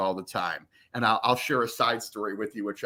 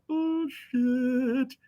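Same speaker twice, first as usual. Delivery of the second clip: high-pitched, drawn-out alarm; mock dread; 12 semitones higher, 3 dB quieter than usual. Broadcaster hearing the coach hunt for him, comically alarmed. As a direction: stretch it out high, as if in trouble.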